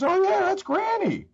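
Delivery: very high, imitated Southern boy; little Southern accent. Little boy recognises his grandmother's name, high Southern accent. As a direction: pipe up high as a Southern child. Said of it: "this little Southern accent".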